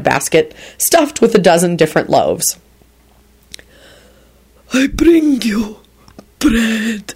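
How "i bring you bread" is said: slow, higher, lustful goblin; breathily lustful. A narrator's reading. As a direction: draw it out in breathy longing. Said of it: "in a breathily lustful tone".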